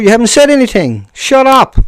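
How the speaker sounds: loud, high-pitched telling-off; exasperated shout; heated outburst. Real, not acted. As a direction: raise your voice sharply at a heckler. Snapping at a commenter.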